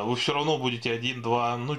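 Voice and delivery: ordinary talk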